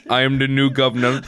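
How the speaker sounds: accent slipping into mock Indian; botched Arnold that turns Indian; accidental Indian accent. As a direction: announce it in a wobbly Indian accent. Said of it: "now it's Indian".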